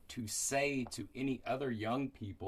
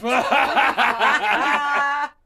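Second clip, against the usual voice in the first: high, loud burst of laughter; big, high-pitched guffaw; 13 semitones higher, 16 dB louder than usual. Real, not acted. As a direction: explode laughing at the answer. Laughing at child's answer.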